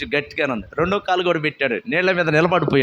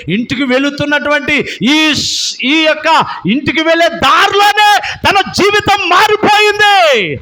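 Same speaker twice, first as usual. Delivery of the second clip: higher, louder preaching at full force; fiery preaching; 11 semitones higher, 7 dB louder, slower than usual. Real, not acted. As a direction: exhort the congregation with fire. Preaching climax.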